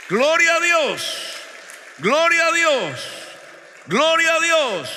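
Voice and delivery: high, ringing exclamation, very loud; slow, loud pulpit acclamation; shouted praise. Real, not acted. Preacher shouts praise to congregation.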